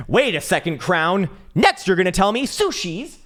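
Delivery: a cartoon investor getting worried; high mock voice. Continues mock voice.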